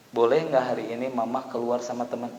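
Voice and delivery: low, soft wife's plea; timid request. Acted. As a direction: ask permission gently and quietly. Wife asks husband's permission.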